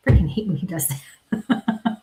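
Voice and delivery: voiceless aside, half to yourself; slow whispered gripe after a spooky moment. Real, not acted. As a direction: grumble it under your breath, slow.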